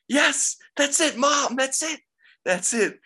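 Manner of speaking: put-on high, slow, loud little-kid cheer; excited kid. Young Cena fan cheering to his mom, high childlike excited voice. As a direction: cheer like a young fan sure his hero has won. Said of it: "is sitting there going".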